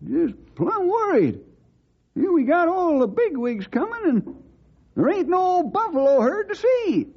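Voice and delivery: put-on high-pitched old cowhand, drawn-out worry; worried old sidekick. Sidekick fretting to friends about the missing herd, high and slow. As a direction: fret slowly in a high old-timer voice.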